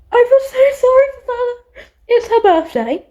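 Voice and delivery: high, loud, teary wail of sympathy; half-crying over the character; mock sob. Real, not acted. Fan to the viewers about the character, in a high teary wail.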